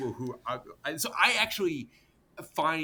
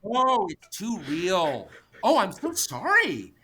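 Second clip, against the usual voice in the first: slow, high, mock-pitying sarcasm; mock-sorry taunt at the tough venture capitalist; sarcastic mockery; 7 semitones higher, 3 dB louder, slower than usual. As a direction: drag it out in fake sympathy, voice up high. Mocking the squeamish investor.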